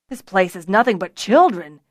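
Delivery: loud, exasperated complaint; angry shout. A narrator's reading. Tamar arrives looking for Fiona, angry shouting. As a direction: shout it out crossly. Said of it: "Tamar shouted, his anger obvious".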